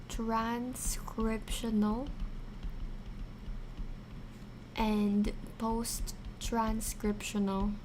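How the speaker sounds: slow, half-whispered key term; slow emphasis. Shown as a demonstration. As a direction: draw the words out for notes. Teacher stressing key terms.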